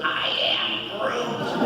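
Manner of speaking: put-on high, slow, breathy little-kid Groot; small child in a Groot costume, slow high voice; imitating little girl as Groot. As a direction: coo it like a tiny child in costume. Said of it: "She goes".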